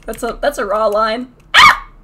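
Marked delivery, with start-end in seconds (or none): 1.5-1.9 short, loud high shriek; sharp burst of a yell; startled scream. Real, not acted. Attacked during the game.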